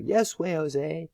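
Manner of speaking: put-on high playful child shout; kids shouting back. Teacher reading the kids' reply, high playful shout. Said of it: "we would shout back".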